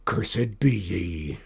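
slow, low curse from a beaten pirate; low, broken pirate voice. A narrator's reading. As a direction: drag out a bitter pirate curse. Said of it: "he said in a low, broken voice".

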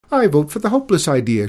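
high, loud, rapid shrill cry; shrill princess. A narrator's reading. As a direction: pipe up shrilly and fast. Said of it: "shrilled the beautiful princess".

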